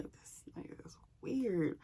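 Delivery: hushed whisper to herself; whispered aside. Retold or imitated. Thinking about her ex on the phone, whispered. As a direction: breathe it like a secret thought. Private thought about ex's call.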